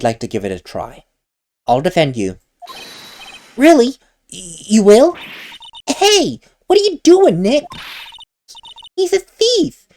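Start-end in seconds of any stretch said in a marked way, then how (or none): 3.6-5.2 high, loud, startled disbelief; surprised gasp. Acted. Maya surprised Nick will defend the thief, loud and startled. 5.9-9.9 put-on much higher girlish voice, upset protest; a small girl scolding, pitched way up; voiced little girl Pearl. Pearl scolds Nick for trusting the thief, high girlish protest.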